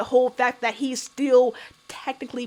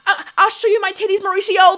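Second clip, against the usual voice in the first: mocking Ashley; 6 semitones higher, 6 dB louder than usual. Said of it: "it gave that".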